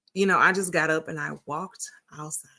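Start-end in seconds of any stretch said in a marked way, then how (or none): 1.1-2.5 whispered. Real, not acted. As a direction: whisper it, hushed. Quiet moment after crying.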